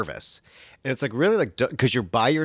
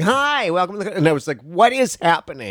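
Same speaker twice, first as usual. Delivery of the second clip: 1 chirpy greeting; 8 semitones higher, 3 dB louder than usual. Retold or imitated.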